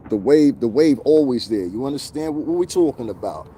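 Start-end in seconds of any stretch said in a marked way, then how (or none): none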